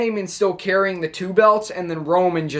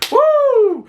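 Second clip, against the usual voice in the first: a high, loud whoop of pure hype; excited woo; 5 dB louder than usual. Real, not acted. Fan whooping at the super kick, excited. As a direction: let out a big celebratory woo. Hyped by wrestling finish.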